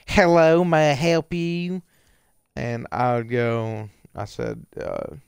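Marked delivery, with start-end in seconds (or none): 0.1-1.8 high, loud, snippy impression of a rude drive-thru worker. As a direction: mimic her curt, pitched-up greeting over the speaker. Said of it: "this is what it sounded like".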